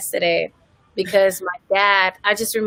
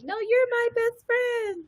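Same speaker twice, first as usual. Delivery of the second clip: high, slow, soft voice; 5 dB quieter than usual. Retold or imitated. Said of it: "I was like".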